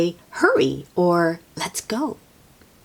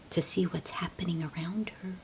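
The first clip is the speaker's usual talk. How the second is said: softer, faster unvoiced aside; whisper; 11 dB quieter, faster than usual. Real, not acted. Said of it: "reader aside whispered".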